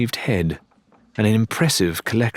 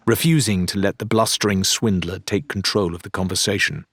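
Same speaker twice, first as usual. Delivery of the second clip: usual speaking voice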